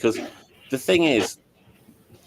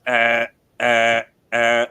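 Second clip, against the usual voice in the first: reversing truck beep; 5 dB louder, much slower than usual. Retold or imitated. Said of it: "a truck reversing ... it goes".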